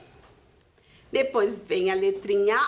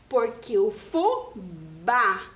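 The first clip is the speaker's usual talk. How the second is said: fubá with the final bá stressed hard, slowly; 3 semitones lower, slower than usual. Shown as a demonstration. Teacher showing kids the accented A in fubá. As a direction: lean on the bá of fubá, slow. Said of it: "eu falo bem forte essa letra A".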